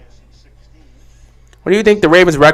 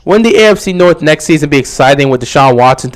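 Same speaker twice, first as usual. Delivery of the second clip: ordinary delivery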